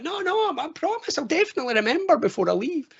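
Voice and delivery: high-pitched, hurried mock assurance; over-eager promise. Man assuring partner he will remember, high-pitched over-eager promise. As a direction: squeak out an earnest promise.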